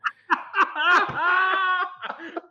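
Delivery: high shrieking burst of laughter; loud high cackle of laughter. Real, not acted. Chicken suit joke.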